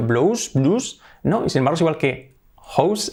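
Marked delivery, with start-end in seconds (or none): 0.0-1.0 "blouse" guessed as blo-us, then bloos; trying wrong vowels on "blouse"; unsure mispronunciations. Shown as a demonstration. Teacher acting a puzzled learner's guesses, mispronounced. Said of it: "cómo la pronuncio?".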